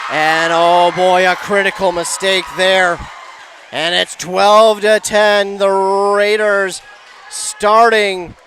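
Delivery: loud, slowed, emphatic score call; excited live commentary. Real, not acted. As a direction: punch out each phrase with rising stakes. Commentator reacts to critical mistake.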